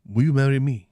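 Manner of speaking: put-on hammy Pepe Le Pew French accent; bad French accent. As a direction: propose in an overdone French accent. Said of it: "in a really bad French accent".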